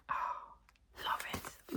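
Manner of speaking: breathy hushed delight; soft whispered love it. Real, not acted. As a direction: murmur it under your breath, thrilled. Admiring find.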